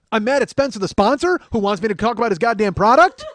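high, loud comic tirade; mad, yelled. Acted. Man answering that he is mad, yelling angrily. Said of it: "He's gonna be so mad".

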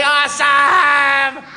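slow, high, loud cheer; cheering shout. Shown as a demonstration. Host shows the kids how loud to cheer, shouting. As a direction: belt it out to pump up the room. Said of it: "Someone say".

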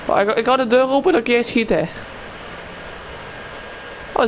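normal talking voice

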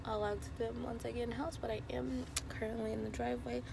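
hoarse, fading voice; raspy whisper. Real, not acted. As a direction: rasp through a lost voice. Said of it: "Sorry, I'm losing my voice".

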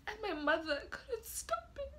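high, choked, tearful voice. Acted. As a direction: fight back sobs. Mourner weeping at eulogy.

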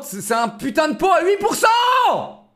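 high, slow, shouted outrage; outraged shout. Real, not acted. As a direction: yell it in disbelief. Outraged at a game worker.

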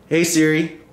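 ordinary talk, nothing marked

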